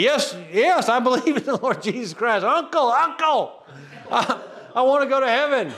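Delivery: high-pitched, hammed-up pleading yes; eager surrender. Preacher acting out instant conversion, mock-frantic and eager. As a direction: cry uncle in comic, eager haste. Acts himself eagerly accepting salvation.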